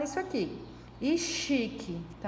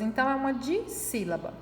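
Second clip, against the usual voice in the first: ordinary delivery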